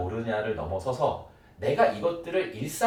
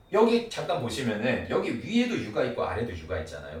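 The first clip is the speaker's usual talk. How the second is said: ordinary talk, nothing marked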